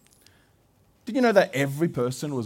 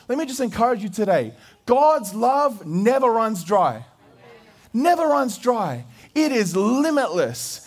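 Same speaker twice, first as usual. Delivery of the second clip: preaching; 10 semitones higher than usual. Acted. Pastor urging the congregation that God's love never runs dry, preaching. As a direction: lean into each phrase like a pulpit climax.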